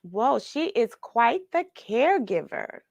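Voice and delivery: usual speaking voice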